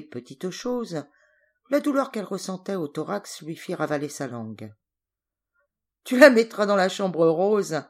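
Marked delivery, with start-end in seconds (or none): 6.0-7.8 loud laughing jibe, much louder; laughing loudly. A narrator's reading. Hunter jokes to his wife with a big laugh. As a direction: laugh out the order. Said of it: "ajouta-t-il dans un grand rire".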